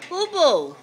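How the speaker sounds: normal talking voice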